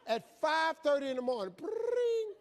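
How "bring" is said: very high trilled phone ring; shrilling mock ringtone; imitated phone ring. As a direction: trill like an old telephone bell. Imitates the telephone ringing.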